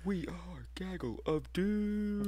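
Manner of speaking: slow, breathy whispered line. Acted. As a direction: whisper it like a secret. Band name joke.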